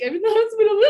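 high, loud, tearful wail; emotional, crying. Retold or imitated. Texting her auntie from the hospital, crying. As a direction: sob it out, half laughing at yourself. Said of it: "all emotional and was like".

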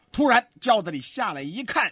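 ordinary delivery

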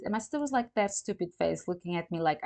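normal talking voice

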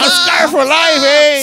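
laughing yell. Real, not acted. Laughing crowd reacting to story.

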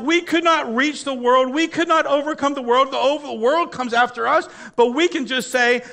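high, loud, fiery sermon climax; full-force preaching. Acted. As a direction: preach it out to the whole church.

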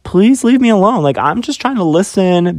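high, exasperated plea to be left alone; annoyed. Retold or imitated. To two girls blaming her for talking, high and exasperated. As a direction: retell snapping at the girls, fed up. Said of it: "I just got annoyed by it".

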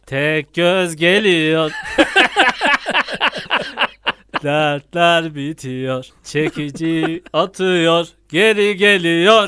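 slow, drawn-out campaign chant; campaign slogan. Pretend. Friend mocking a Thor election campaign, slow slogan chant. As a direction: drag each slogan out like a loudspeaker.

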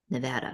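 quiet, tentative nuh-VA-duh for "Nevada"; careful Nevada pronunciation. Shown as a demonstration. Host practising the difficult sound, soft "Nevada" with the "a" of "cat". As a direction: softly try "Nevada" with short "a" as in "cat". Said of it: "I have to move my face different".

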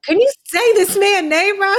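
high teasing laugh; giggling while teasing. Real, not acted.

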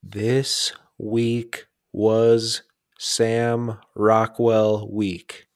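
word by word, with long gaps; deliberately drawn-out announcement; very slowly. Real, not acted. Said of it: "I wanna tell you this very slowly".

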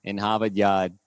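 slow, dropped-r Boston accent; lightly done Boston accent. Shown as a demonstration. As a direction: pahk the car in Hahvahd Yahd. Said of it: "¿Y el de Boston se te hace más fácil?".

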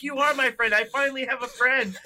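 high, touched robot voice; happy robot. Retold or imitated. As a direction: gush like a lonely robot. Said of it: "And he's like".

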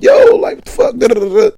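high, loud riled-up yell. Retold or imitated. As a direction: yell it like a hyped streamer. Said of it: "Akademiks is riling himself up".

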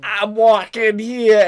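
slow loud New York yell; New York accent. Pretend. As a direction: shout it like an angry New Yorker. Joking New York talk.

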